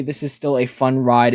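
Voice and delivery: ordinary talk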